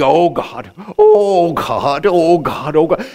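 drawn-out imitation of altar begging; mock wailing plea. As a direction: wail it like an overdone, desperate prayer. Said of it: "they'd cry, and they'd wail, and they'd beg".

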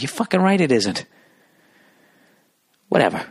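normal talking voice

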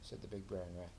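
usual speaking voice

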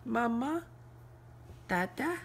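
put-on Mama and Dada rising high like a baby's first words; baby talk. Shown as a demonstration. Parent asked by child says the baby's first words cooingly. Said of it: "No, you say it".

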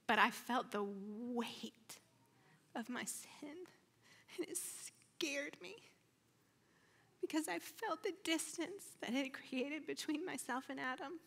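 breaking up with emotion; real tearfulness. Real, not acted. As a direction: struggle to get it out through tears. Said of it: "I'm gonna cry again".

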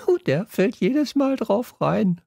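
put-on very high-pitched, gleeful girl's voice; giggling aside. A narrator's reading. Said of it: "ergänzte Bella kichernd". (kichernd, mit hoher Stimme)

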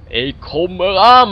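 slow, loud drawled call. Pretend. Player drawls at his slow character: slow drawl. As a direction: drag the words out like a lazy walker. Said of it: "mijn drawl".

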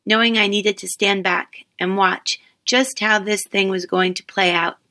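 plain reading voice

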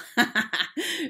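high, loud acted cackle; teasing burst of laughter; mocking laugh. A narrator's reading. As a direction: laugh at your own taunt. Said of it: "She laughed".

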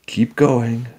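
tense encouragement; urging on. A narrator's reading. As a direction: urge her to keep moving. Jack urges Annie to crawl.

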